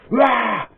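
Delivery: a loud retold roar at the kids; loud mock roar. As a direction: bark a sudden roar like a lout. Thugs roaring at kids.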